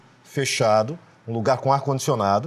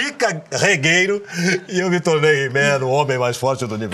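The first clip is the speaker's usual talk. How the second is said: He-Man closing; 4 dB louder than usual. Shown as a demonstration. Voice actor finishing the He-Man opening, heroic. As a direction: finish the intro heroic while still laughing. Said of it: "imitar alguma coisa do He-Man".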